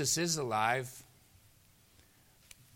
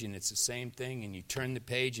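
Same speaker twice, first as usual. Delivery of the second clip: normal talking voice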